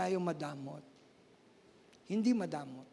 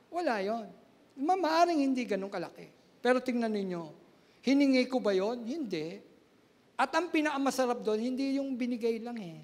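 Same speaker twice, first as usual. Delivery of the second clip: ordinary talk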